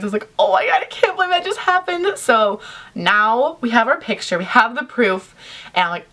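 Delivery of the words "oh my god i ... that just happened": high-pitched retold amazement; shaken disbelief. Fan just after the encounter, acted high disbelief. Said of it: "I was, like, shaking afterwards".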